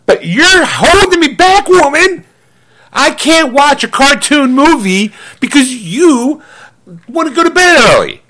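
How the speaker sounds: high, slow hammed-up protest at the wife; mock outburst. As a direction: whine it out as a fake grievance. Mock complaint to wife, woman!.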